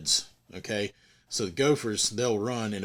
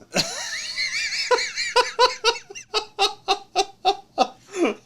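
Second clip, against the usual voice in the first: big laugh; 4 dB louder than usual. Real, not acted. Narrator cracks up at story.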